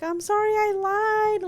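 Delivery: high, drawn-out mock wail; slow, whiny retold cry; imitated crying child. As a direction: mimic a little girl's tearful confession.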